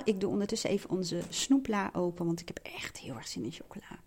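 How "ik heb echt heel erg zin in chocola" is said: whispering. Real, not acted. Opening the sweets drawer quietly.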